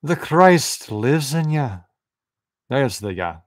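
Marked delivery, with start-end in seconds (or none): 0.0-1.7 higher, louder Scottish lilt; Scottish accent. Shown as a demonstration. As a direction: proclaim it with a stronger Scottish accent. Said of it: "more Scottish".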